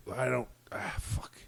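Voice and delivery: whispered, caught-out curse under the breath; muttered curse. Retold or imitated. The stuck agent to himself, whispered curse. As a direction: let the swear slip out in a whisper.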